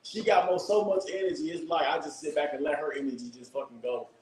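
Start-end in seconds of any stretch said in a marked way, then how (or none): none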